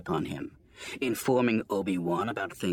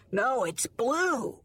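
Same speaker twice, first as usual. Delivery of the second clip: put-on child voice; 13 semitones higher, much slower than usual. A narrator's reading. Youngling corrects, slow high.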